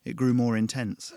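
ordinary delivery, nothing marked

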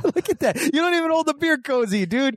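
high incredulous ribbing; mocking. Real, not acted. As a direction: scoff at him way up high. Mocking friend's beer cozy.

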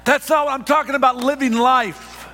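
high, loud, driving sermon delivery; full-force preaching. Acted. Pastor to congregation, preaching at full force.

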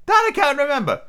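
high, fretful Hatter; nervous Hatter voice. Acted. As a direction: plead it high and thin.

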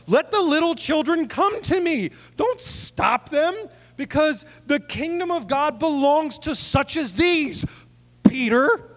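high, loud indignant rebuke; indignant Jesus. Shown as a demonstration. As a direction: scold the disciples, glaring at Peter. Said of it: "Jesus is indignant".

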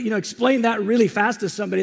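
normal talking voice

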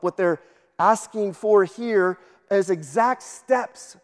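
ordinary talk, nothing marked

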